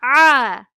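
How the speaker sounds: A pressed loud from the throat; throaty A. Shown as a demonstration. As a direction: drive the A from the throat. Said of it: "A harfimiz gırtlak kısmından çıkarılır".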